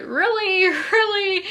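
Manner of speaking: high, disbelieving double take; mock disbelief at the book; incredulous really. Reacting to quirky dialogue, high incredulous repeat. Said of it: "it just makes me just be like".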